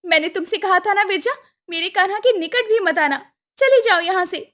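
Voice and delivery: high, indignant goddess voice; jealous scolding. Acted. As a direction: order your rival away. Acted quarrel, jealous Radha scolds rival.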